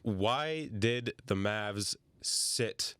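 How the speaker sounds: normal talking voice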